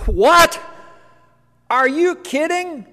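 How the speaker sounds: loud, high-pitched mock disbelief; incredulous outburst. Angels questioning Jesus's choice, acted incredulous outburst. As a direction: blurt it out, high and aghast.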